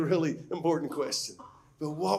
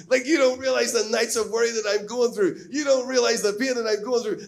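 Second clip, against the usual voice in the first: louder, exasperated rant at a glib comforter; frustrated outburst; 4 semitones higher, 5 dB louder than usual. Retold or imitated. Preacher voicing frustration at a glib friend, exasperated and louder. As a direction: let the frustration spill out, pushing it.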